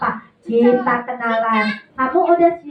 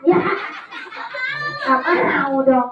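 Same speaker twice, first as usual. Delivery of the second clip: ordinary delivery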